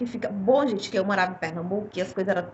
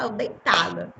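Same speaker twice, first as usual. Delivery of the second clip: ordinary talk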